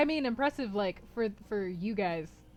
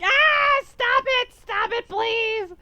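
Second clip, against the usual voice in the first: much higher and louder, mock begging to stop; mock pleading; 12 dB louder, much slower than usual. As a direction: squeal it like a friend cringing at your accent. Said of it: "going like".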